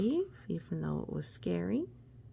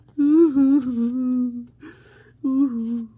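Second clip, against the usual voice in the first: wailing sob; 7 semitones higher, 13 dB louder than usual. Acted. Dragon cries, loud.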